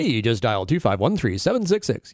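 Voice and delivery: loud, playful radio DJ patter; hammed-up radio host delivery. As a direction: belt it out like an on-air announcer. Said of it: "shipping to that radio voice".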